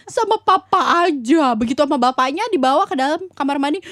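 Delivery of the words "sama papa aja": high, slow, loud kiddie whine; imitated whining child. Child asking mama for papa instead, drawn-out mock whine. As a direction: draw it out like a pleading toddler. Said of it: "dia teriak-teriak".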